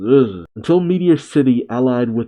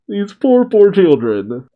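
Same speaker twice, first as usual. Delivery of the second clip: "poor" pushed up high, then drawn out; dismayed, lingering weight on "poor"; stress on poor; 7 semitones higher than usual. Real, not acted. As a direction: lean hard on both times you say "poor". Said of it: "Emphasis on poor".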